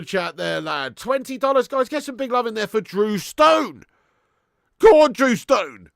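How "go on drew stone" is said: cheer. Real, not acted.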